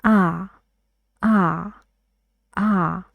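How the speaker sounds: a dark ah from the back of the mouth. Shown as a demonstration. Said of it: "Here you raise it".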